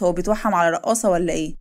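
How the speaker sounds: ordinary delivery, nothing marked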